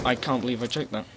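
ordinary talk, nothing marked

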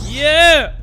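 high, loud whoop; real hype shout; excited yell. Real, not acted. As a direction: let out a pumped-up cheer. Hyping up during game.

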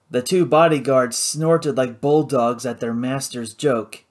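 usual speaking voice

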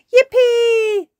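put-on high, loud child's cheer. A narrator's reading. Peppa about to be tucked in by Granny, cheering. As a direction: whoop with delight like a little girl. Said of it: "cheered Peppa".

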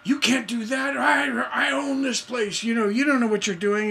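high, indignant mock shouting; kicking and screaming protest. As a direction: protest like someone being dragged out. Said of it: "it'll be kicking and screaming".

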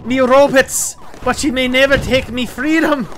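rally the robots like a Braveheart warrior; mock Scottish battle cry. Gamer rallying his robots, hammed-up Scottish cry.

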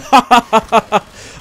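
loud high cackle of triumph; laughing at his trap; gleeful laugh. Real, not acted. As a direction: burst out laughing in glee. Laughs at kills.

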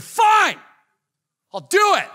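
high, exasperated shout; loud, petulant caving in; angry giving in. Retold or imitated. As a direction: explode in grudging surrender. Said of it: "they're angry while they're doing it".

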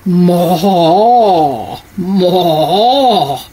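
low drawn-out moo; low like an old buffalo. Acted. Reader voicing the buffalo's moo.

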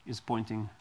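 normal talking voice

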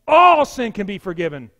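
loud, ringing declaration; emphatic proclamation. Real, not acted. Preacher declares forgiveness to his congregation, loud and emphatic. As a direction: proclaim it loudly to everyone.

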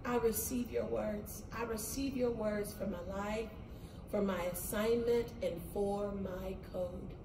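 very soft, hushed prayer; prayer to repeat. Shown as a demonstration. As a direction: murmur it like a devotion with hands up. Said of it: "and just say".

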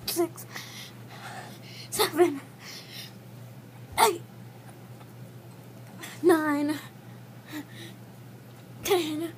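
straining, breathless count; doing push ups. Real, not acted. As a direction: count out loud through the strain. Push-ups counted during one minute.

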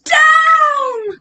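high, drawn-out cry; storybook witch crying out; witch's cry. A narrator's reading. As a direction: call it out high for a young audience. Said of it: "cried the witch".

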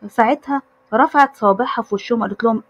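plain reading voice

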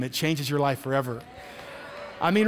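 normal talking voice

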